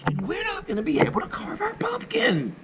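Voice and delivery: put-on very high, breathy girl voice; sad little girl. Joan tells the club, high and breathy. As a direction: sigh it out like a sad child.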